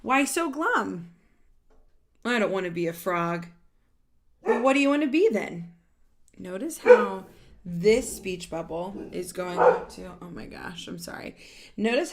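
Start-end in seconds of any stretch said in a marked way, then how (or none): 0.0-5.7 louder, lively read-aloud of a character line; animated storybook voice. Acted. Reading a picture book to children, animated and louder. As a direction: voice the characters brightly for the kids.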